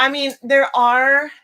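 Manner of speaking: loud drawn-out yell. Real, not acted. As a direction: yell it out slowly. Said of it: "I'm yelling".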